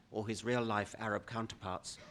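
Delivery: normal talking voice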